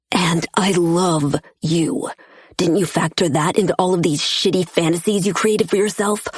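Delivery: usual speaking voice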